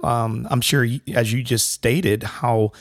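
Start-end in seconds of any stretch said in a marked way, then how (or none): none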